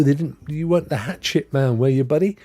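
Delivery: ordinary talk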